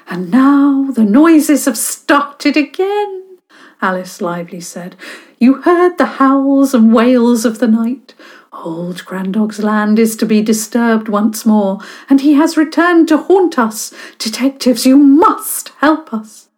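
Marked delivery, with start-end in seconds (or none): 0.1-3.4 high, slow spooky tale-telling voice; dramatic storyteller. Acted. Alice resumes spooky tale. 5.4-16.5 high, loud theatrical begging; dramatic plea. Acted. Alice pleads with the detectives.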